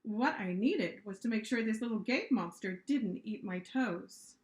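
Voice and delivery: ordinary delivery, nothing marked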